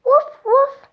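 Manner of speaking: high, loud playful puppy woof; puppy bark. Acted. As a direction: bark it like an excited little puppy. Dog Biscuit barks in story.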